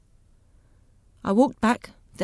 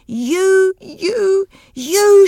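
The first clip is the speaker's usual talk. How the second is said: shrill high scream, far louder; 11 semitones higher, 9 dB louder, much slower than usual. Acted. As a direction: scream it at him in rage. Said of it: "she screamed".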